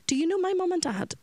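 put-on high, small plaintive child's question; imitating a little boy asking for his parents.

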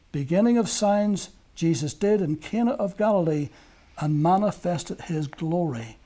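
ordinary delivery

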